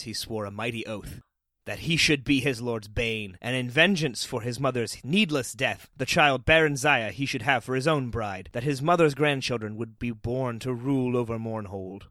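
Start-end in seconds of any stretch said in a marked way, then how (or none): none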